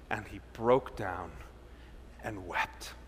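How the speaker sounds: narrating Peter's bitter weeping; sorrowful. A narrator's reading. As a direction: let the grief settle.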